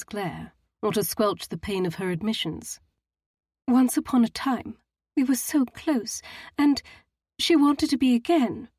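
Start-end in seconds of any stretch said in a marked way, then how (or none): 3.7-8.7 trembling, near tears. A narrator's reading. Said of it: "Her lower lip trembled".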